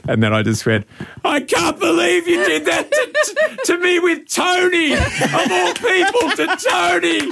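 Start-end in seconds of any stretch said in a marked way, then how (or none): 1.2-7.2 much higher, laughing fake outburst of betrayal; hammed-up jealous scream in the street; mock jilted-lover yell. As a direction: shout it like a wronged lover making a scene, cracking up. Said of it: "I'm going to yell out and say that you cheated on me".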